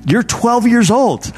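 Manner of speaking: high, drawn-out mock disbelief; mock incredulity. Youth teacher's first thought about the boy, mock incredulous. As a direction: playfully scoff at a child's earnestness. Preacher's joking reaction to boy.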